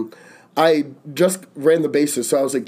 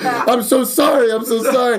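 mock crying apology; 7 semitones higher than usual. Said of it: "crying at my knees, saying".

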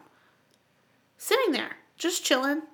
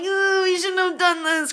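put-on higher fretful child voice; anxious little kid; mock worried; 6 semitones higher than usual. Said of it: "I'm like".

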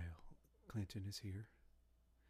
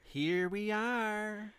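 slow, drawn-out, higher and louder; catchphrase delivery; 10 semitones higher, 6 dB louder, much slower than usual. Shown as a demonstration. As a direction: land it like a comedy tag line. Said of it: "that's my comedy catchphrase".

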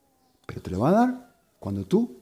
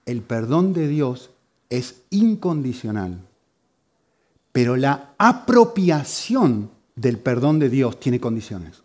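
slow, measured delivery of "incondicional" and "condiciones"; careful explanation; much slower than usual. Shown as a demonstration. Preacher explaining an important difference to the congregation, careful and slow. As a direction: set out "El perdón de Dios" slowly, phrase by phrase. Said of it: "muy importante entender la diferencia".

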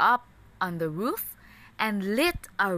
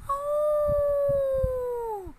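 much higher, long held "Oh"; big bad wolf's call. A narrator's reading. Wolf calling at the straw house door, long high "Oh". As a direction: stretch the Oh like a sly wolf calling. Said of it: "said the little pig".

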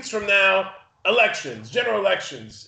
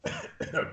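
coughing up water; 2 semitones lower, 10 dB quieter than usual. Real, not acted. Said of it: "water went down the wrong pipe".

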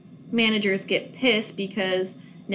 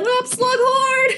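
much higher, slow shouted greeting; mock greeting shout; 13 semitones higher, 4 dB louder, slower than usual. Retelling greeting Slughorn on screen, shouted. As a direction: hail the actor like an old pal. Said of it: "I was like".

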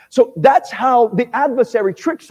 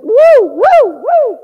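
loud high whooping like a clubgoer; mock club cheer; 12 semitones higher, 8 dB louder, much slower than usual. Preacher mimicking partygoers, hyped whoop.

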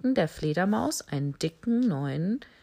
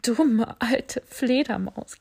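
giggling; 5 semitones higher, 4 dB louder, slower than usual. Acted. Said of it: "kicherte die Giraffe".

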